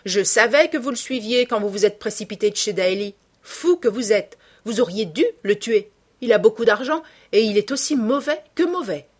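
high, loud, scolding woman; haggard bar woman, vehement. Acted.

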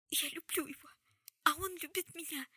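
high, voiced tearful plea, no real whisper; tearful confession. A narrator's reading. Jessamine confessing her love to Charlotte, high and tearful. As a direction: confess it as if bursting into sobs. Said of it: "Джессамина разрыдалась и прошептала".

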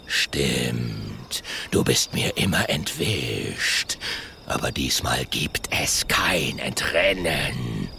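low, slow, whispered threat; menacing dinosaur. Acted. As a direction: savour every word darkly. Ultimo threatens.

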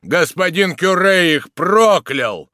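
loud, slow, heavy proclamation; chorister's bass bark. A narrator's reading. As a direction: boom out the curse like a church bass. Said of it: "рявкнул бас певчего".

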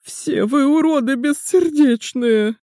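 high, slow hammed-up wailing; fake-cry it like a drama queen; theatrical sobbing. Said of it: "картинно зарыдал".

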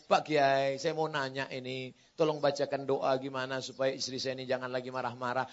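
low, soft pleading to a cleric; meek husband asking. Acted. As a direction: ask humbly in a low, subdued voice. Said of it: "datang ke Pak Kiai". (suami memohon dengan suara rendah dan pelan)

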